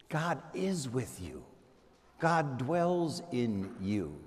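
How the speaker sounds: soft, slow intimate assurance; hushed affirmation. Acted. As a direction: let it sink in quietly. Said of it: "closing affirmation".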